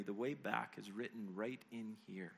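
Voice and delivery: low, soft, tender reassurance; hushed narrated comfort; gentle. A narrator's reading. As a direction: console softly and without hurry. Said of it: "the woman said gently".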